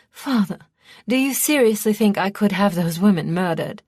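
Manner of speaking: affronted, emphatic question; appalled protest. A narrator's reading. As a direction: protest to a father in disbelief. Daughter protests father's murder accusation.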